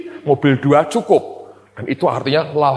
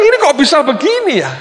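incredulous; 13 semitones higher, 5 dB louder, faster than usual. Retold or imitated. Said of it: "Jadi saya bilang".

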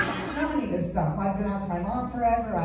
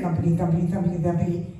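muted thumping imitation; 4 semitones lower, faster than usual. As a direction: lower thumpity thumpity, much lighter than before the pounding. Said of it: "trying to pretend I don't hear it".

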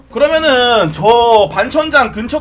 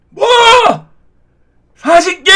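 put-on loud, high old man shout; grumpy old man; 12 semitones higher, 7 dB louder than usual. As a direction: shout like an outraged grandpa. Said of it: "여기 있는 틀딱들이". (버럭하는 할아버지 목소리)

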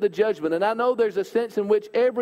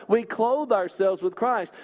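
ordinary delivery

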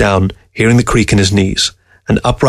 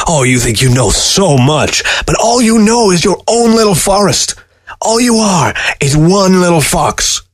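put-on angry old man; 9 semitones higher than usual. A narrator's reading. Newberg yelling, spittle flecking.

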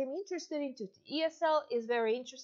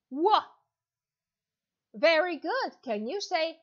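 put-on louder, bright teacher voice for a young child; demo kids' lesson; 4 dB louder than usual. Shown as a demonstration. Teacher to little John in China, upbeat and encouraging. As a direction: cheer on a five-year-old online student. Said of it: "we're gonna go on to our demo lesson".

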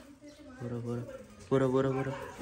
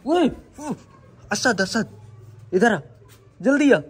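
calling out; 8 semitones higher than usual. Real, not acted. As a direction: call him over urgently. Calling Asad over.

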